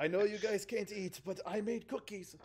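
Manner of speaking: put-on Russian accent. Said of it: "doing a terrible Russian accent".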